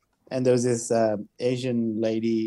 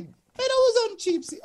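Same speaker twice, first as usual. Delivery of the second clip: much higher, wounded pride; indignant disbelief. Retold or imitated. Comedian protesting to the supermarket guy, high mock outrage. Indignant inner reaction to being forgotten.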